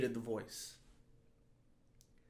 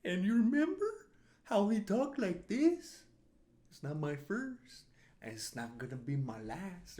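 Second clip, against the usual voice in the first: cartoonish swinging-pitch impression; 5 semitones higher than usual. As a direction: talk like an exaggerated screen brother, lilting upward. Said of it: "[name]' brother from La, La Bamba, [name]".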